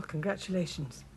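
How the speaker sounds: half-hearted, low-key well-wishing; unsmiling congratulations. Acted. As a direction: congratulate without any enthusiasm. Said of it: "you could smile a bit".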